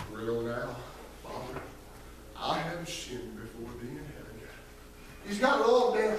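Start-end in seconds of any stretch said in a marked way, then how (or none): 0.1-4.4 much lower, much softer practiced apology, like a son running his lines; a penitent son trying out his speech, low and hushed; low, hushed, rehearsed confession to his father; rehearsed humble plea. Retold or imitated. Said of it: "had his voice inflections just right".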